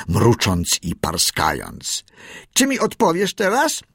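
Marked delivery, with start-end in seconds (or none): 2.5-3.8 put-on high-pitched young seal demanding an answer; piping little seal's question; Kotik asking. A narrator's reading. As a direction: ask it high and insistent. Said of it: "spytał zmęczony podskakiwaniem Kotik".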